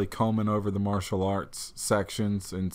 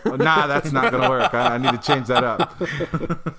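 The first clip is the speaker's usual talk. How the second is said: louder, amused, laughter running through it; laughing while talking; 4 dB louder than usual. Real, not acted. Player rejecting his own practice voice, laughing as he talks.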